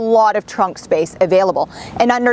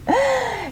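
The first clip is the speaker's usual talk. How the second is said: soft laugh; 5 dB quieter than usual. Real, not acted. Laughs briefly.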